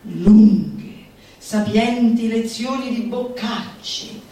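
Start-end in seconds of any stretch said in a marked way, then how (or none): none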